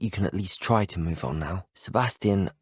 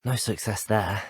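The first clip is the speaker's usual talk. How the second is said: ordinary talk, nothing marked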